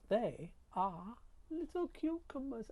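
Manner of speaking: high, slow, cooing delight. Real, not acted. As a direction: coo over tiny cucumbers. Showing new cucumbers delighted.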